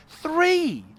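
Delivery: high, loud cry of disbelief; shocked shout. Real, not acted. Seeing three cannons on the plane, a high loud shocked shout. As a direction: yell the number in shock.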